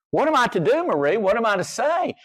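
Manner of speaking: high, pleading what-now question; exasperated. Real, not acted. Preacher to Marie, exasperated. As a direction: throw up your hands to the congregation.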